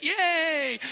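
very high, loud yay; mock cheer. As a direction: squeal of playful delight. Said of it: "We're like".

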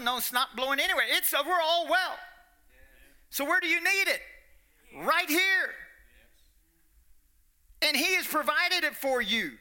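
rally the congregation with rising fervor; full-force preaching. Acted. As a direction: hellfire preacher building to a shout.

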